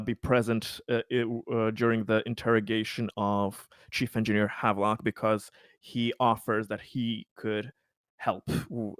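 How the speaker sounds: ordinary delivery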